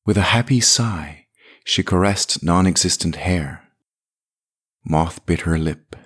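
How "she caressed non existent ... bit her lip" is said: ordinary delivery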